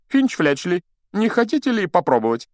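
high, loud, inviting teacher; firm Lockhart. A narrator's reading. Teacher asking a pupil to try, firm and high. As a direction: invite him in a high, projected voice. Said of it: "сказал твёрдым голосом".

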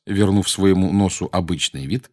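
plain reading voice

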